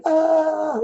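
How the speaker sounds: high, sustained "ah"; mock crying wail. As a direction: hold a high pretend bawl. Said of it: "or crying very loudly".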